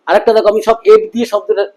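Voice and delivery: normal talking voice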